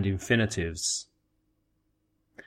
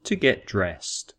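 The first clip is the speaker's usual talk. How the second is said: "To get dressed" said slowly and evenly; slow teacher model of "To get dressed"; slow model phrase; 3 semitones lower than usual. Shown as a demonstration.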